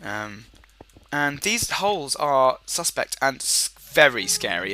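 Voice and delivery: usual speaking voice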